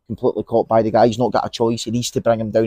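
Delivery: ordinary delivery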